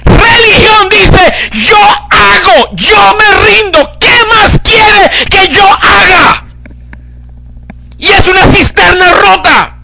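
high, loud, slow preacher's cry; full-force preaching. Acted. Preacher cries out religion's plea to the congregation, loud and slow. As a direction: thunder it from the pulpit.

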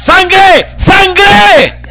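high, loud call of the word Sangre; shouted cry. Acted. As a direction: cry out Sangre with all your strength. Preacher crying out the word. (gritando Sangre a pleno pulmón)

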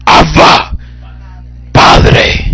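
forceful, hoarsely breathed call; clamor. Shown as a demonstration. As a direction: call out each word firmly, pausing between. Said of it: "tú puedes clamar". (clamor enérgico y pausado)

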